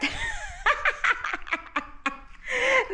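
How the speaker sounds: a high burst of laughter; high giggling laugh. Real, not acted. Laughing at her own shorts.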